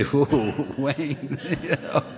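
laughing through a surprised whoa; chuckling hello to the boy who knocked him out; laughing greeting. Retold or imitated. Laughing greeting imagined old opponent.

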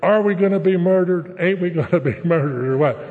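high, loud, panicky retelling of the wife; mock hysterical screaming; frightened wife shouting her fear at him. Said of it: "My wife's screaming hysterically".

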